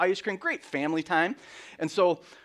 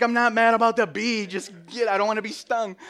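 defensive yell; 8 semitones higher, 8 dB louder than usual. Retold or imitated. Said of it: "she thought I was yelling".

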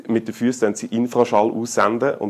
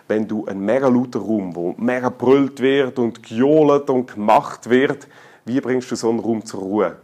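ordinary delivery

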